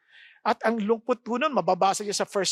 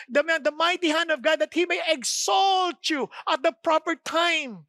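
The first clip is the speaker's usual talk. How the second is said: preaching shout; 4 semitones higher than usual. Acted. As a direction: proclaim the promise at full voice.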